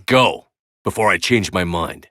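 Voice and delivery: ordinary delivery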